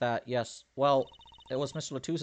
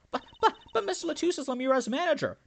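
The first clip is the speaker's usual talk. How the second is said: flustered protest; 14 semitones higher, faster than usual. Acted. Character protests urgently.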